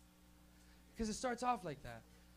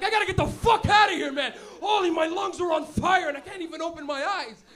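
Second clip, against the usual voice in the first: higher, much louder laughing shout; panicked shout; 8 semitones higher, 15 dB louder than usual. Retold or imitated. Choking kid in a smoky car, panicked laughing shout. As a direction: yell it, lungs burning. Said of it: "you're like".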